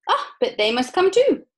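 high, bright exclamation; cheerfully insistent; excited cheerful. Acted. Wonka replies in story.

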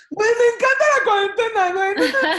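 mock ecstatic cheer, half laughing; giddy delight. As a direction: gush like lockdown is the best thing ever. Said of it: "Hay días que te levantas y dices".

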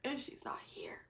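breathy whispered grief. Real, not acted. Vlogger grieving her absent mom, whispered. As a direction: whisper it as the hurt sinks in.

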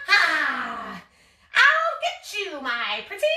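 sneering panto villain threat; Wicked Witch. Acted. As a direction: snarl it like the witch. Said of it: "like you're the Wicked Witch".

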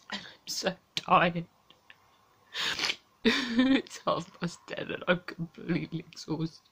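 quiet, tearful and worn out; exhausted, close to crying, barely above a murmur; near tears. Real, not acted.

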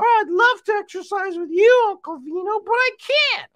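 very high puppet voice, protesting; high cartoon voice, punching the last words; Marmalade. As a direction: squeak it out as a little puppet friend.

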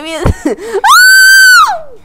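sudden high scream through a laugh. Real, not acted. Girl shrieking mid-laugh as the game surprises her, high.

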